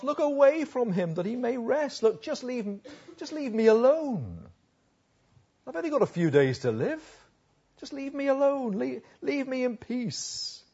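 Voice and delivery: high-pitched, pleading to be left in peace; exasperated plea. Acted. Paraphrases Job begging God for peace.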